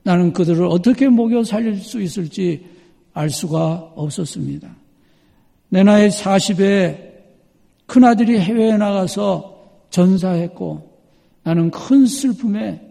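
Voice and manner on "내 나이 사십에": ordinary talk, nothing marked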